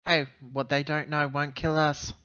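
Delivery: ordinary talk, nothing marked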